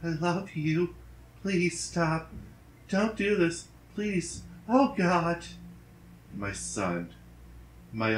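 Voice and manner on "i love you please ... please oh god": high, crying, begging through sniffles; tearful wife begging her husband not to shoot, voice high and breaking; sobbing plea. Acted. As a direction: plead through tears, much higher. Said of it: "dripping nose and sniffling was causing her to muddle her words".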